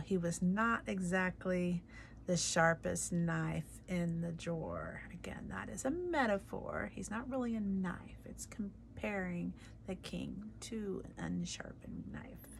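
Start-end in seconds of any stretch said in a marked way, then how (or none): 5.1-12.5 whispered teacher's aside; hushed aside. Real, not acted. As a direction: lean in and whisper the explanation.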